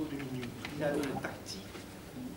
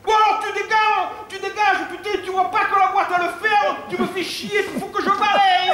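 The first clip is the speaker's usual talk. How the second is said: furious shout; 12 dB louder than usual. Retold or imitated. Girl yells at him to leave.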